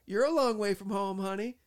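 high, loud imitation of the wife; Patty. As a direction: call it back as the star's wife. Patty's reply acted out.

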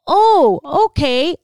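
loud high oh, then a thrown okay; taken aback but going along; awkward surprise. Retold or imitated. Friends caught off guard by unannounced guests, awkward surprise.

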